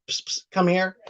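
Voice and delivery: usual speaking voice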